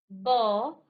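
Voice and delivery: ब said loud with both lips pressed and popped; loud demo ba. Shown as a demonstration. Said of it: "जब आपने कहा ब".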